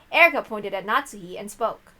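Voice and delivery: ordinary delivery, nothing marked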